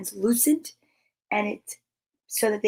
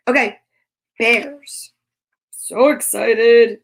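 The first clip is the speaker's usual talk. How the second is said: slow, louder drawn-out thrill; excited; 5 dB louder, much slower than usual. Real, not acted. Teacher tells the kids she's thrilled about bears, slow and louder. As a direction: stretch it out with glee. Bursting before the bear book.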